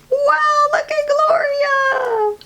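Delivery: high delighted shout; excited shout. Acted. Students cheering at the assembly. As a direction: shout with amazement. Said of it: "the students jumped to their feet".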